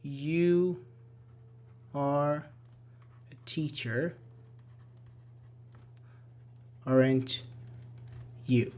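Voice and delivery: word by word, long pauses between; writing while speaking. Real, not acted. Teacher writing a sentence for students, halting word by word. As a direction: dictate it slowly as you write. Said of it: "let me write a sentence".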